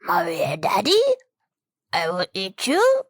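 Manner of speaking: put-on little monster-baby voice, rising at phrase ends; pinhead baby voice. Imagining a pinhead baby talking to its parents, creepy baby voice. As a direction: play a creepy baby demon, tiny menace. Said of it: "this fucking voice".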